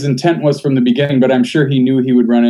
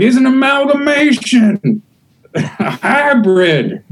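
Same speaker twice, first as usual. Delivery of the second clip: high, drawn-out hammed-up outrage; 13 semitones higher, slower than usual. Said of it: "those people that are racist".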